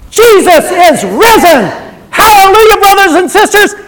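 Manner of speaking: loud, high, ringing sermon voice; preaching at full force. Acted. Preacher urging the congregation to spread the news, preaching loud. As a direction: rouse the church, full throat.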